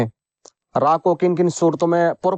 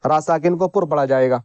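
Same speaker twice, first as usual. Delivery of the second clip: usual speaking voice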